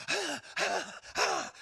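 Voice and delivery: hammed-up frantic "ah" noises; mock frantic cries. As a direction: act a kid in a morning frenzy. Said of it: "where they're just frantic".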